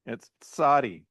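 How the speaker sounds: Sadi with a t sound before the s, like tsadi. Shown as a demonstration. Teacher showing students the right way to say Sadi, ts first. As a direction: say Sadi starting with a crisp ts. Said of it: "you make the T sound before the S sound".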